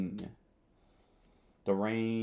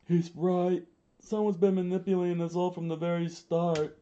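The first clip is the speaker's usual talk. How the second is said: high, raised character voice; rebel leader; 8 semitones higher than usual. A narrator's reading. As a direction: declare it in a raised, strained voice. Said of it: "Koza, uh, said".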